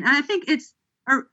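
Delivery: ordinary talk, nothing marked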